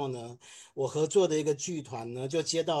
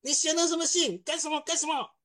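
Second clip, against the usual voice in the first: much higher, exasperated scolding; scolding boss; 12 semitones higher than usual. Retold or imitated. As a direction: play a boss who can't believe the letter. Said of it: "他就骂我说".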